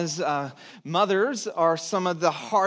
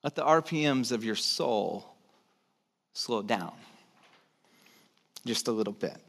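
calm and soft; 9 semitones lower, 6 dB quieter than usual. Real, not acted. Pastor calming the congregation, soft and low. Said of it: "guided calming moment".

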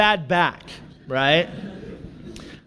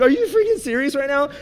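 high, fast, exasperated comeback; retold indignant disbelief; incredulous retort; 9 semitones higher, faster than usual. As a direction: fire back in mock outrage. Acted comeback in marital quarrel.

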